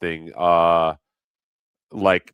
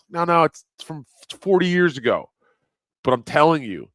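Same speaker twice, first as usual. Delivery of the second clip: high, louder eager insisting; insistent correction; 9 semitones higher, 6 dB louder than usual. Retold or imitated. Correcting the cashier about the film, insistent. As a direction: push the point, keyed up. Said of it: "I was like".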